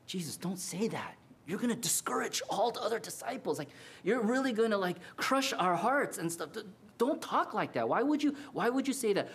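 hushed, quick pleading, voice pulled back; pleading protest. Retold or imitated. Peter's imagined pleading rebuke.

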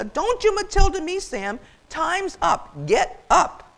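high, loud ultimatum; emphatic, exasperated command; fierce scolding. Acted. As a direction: cut him off and order him up. Goose cuts off turkey's protest.

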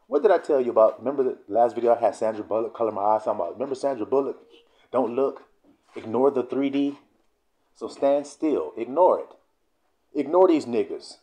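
ordinary talk

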